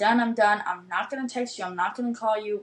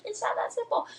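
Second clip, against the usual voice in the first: much higher, breathy-sounding mock heroine; Notebook heroine quote; 13 semitones higher than usual. Mimicking the Notebook heroine's reply, high and dramatic. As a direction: deliver it like the tearful heroine. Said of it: "referencing that fucking Notebook movie".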